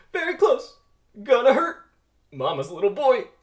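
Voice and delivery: very high, loud frightened cries; terrified squeals; panicked yelps. Acted. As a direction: yelp on each bounce. Barry bouncing on ball, terrified.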